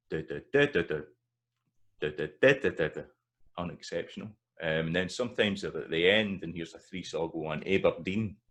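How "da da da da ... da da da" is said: stressed da hit louder among light ones; stress rhythm. Shown as a demonstration. Tutor chanting the stress pattern of unexceptional. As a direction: beat out the syllables, leaning on the stressed one.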